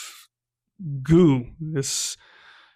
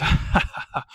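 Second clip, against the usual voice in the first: breathy mock villain laugh; a voiceless evil chuckle. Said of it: "the governor turns and he goes".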